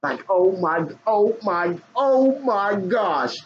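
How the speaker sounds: high, slow, stunned oh my gosh; mock shocked gasp. Viewer gasping at his wild show, mock shock. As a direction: act out a viewer too shocked to breathe. Hand over your mouth.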